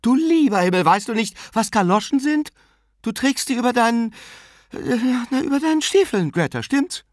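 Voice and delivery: put-on high, loud, flustered old aunt; exclaiming. A narrator's reading. Aunt explaining galoshes to her sister, exclaiming. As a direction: exclaim impatiently, stumbling for the explanation. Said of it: "rief ihre Schwester aus".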